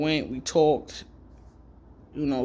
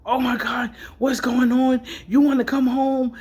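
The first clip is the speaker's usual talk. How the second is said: worried mother; 8 semitones higher, 5 dB louder than usual. Retold or imitated. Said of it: "So she's like".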